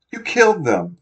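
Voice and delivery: high, loud shocked cry. A narrator's reading. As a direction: burst out in horror. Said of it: "the boy cried".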